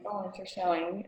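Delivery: ordinary talk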